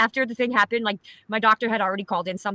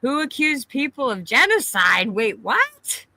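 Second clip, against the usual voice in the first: slow, drawn-out disbelief; surprised; 4 semitones higher, 4 dB louder, much slower than usual. Real, not acted. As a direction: stretch it out, baffled. Reacting to a chat comment.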